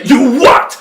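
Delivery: sudden high, very loud yelp; outraged double take. Acted. Wolf learning the child used his credit card, shocked shout. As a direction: explode in disbelief.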